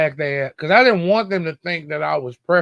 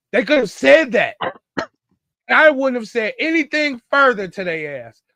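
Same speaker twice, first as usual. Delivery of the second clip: higher and louder in real exasperation; exasperated; 7 semitones higher, 5 dB louder than usual. Real, not acted. Venting to the hosts about the friend, in real exasperation. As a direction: let the frustration boil over.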